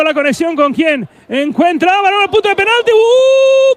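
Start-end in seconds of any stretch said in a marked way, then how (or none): 2.9-3.8 surprised gasp. Real, not acted. Near miss by Utebo.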